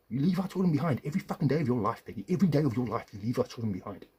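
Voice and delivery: angry accusation. Real, not acted. Husband accusing ex-wife of abandoning kids, loud angry. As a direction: throw it at her in rage.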